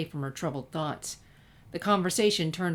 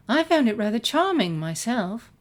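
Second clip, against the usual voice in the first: higher, louder refined lady voice; Rarity answering Sunset about the book, in a higher refined voice; lightly voiced Rarity; 5 semitones higher, 6 dB louder than usual. A narrator's reading. A hint of a refined lady, pitched up.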